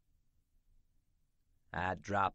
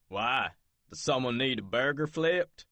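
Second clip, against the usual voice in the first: sneering, higher voice; mocking boss; 6 semitones higher, 7 dB louder than usual. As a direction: tease her, a touch snide. Other Jack mocking.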